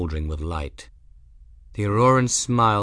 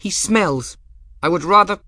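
put-on high, loud girl's protest; defiant girl; 11 semitones higher, 6 dB louder than usual. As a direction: spit it out, cut off mid-word. Bridget's defiant lines.

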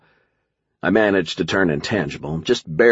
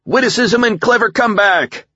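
high-pitched rallying yell; shouted battle cry; 4 dB louder than usual. A narrator's reading. Narrator out of funny rejoinders charges the enemy, high shouted battle cry. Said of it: "shouting out my battle cry".

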